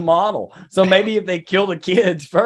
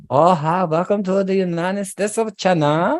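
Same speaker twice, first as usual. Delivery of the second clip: higher put-on welcoming voice; mock Chinese greeter; 4 semitones higher than usual. Imagined host welcoming people to the United States of China, higher put-on voice. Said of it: "it's gonna be like".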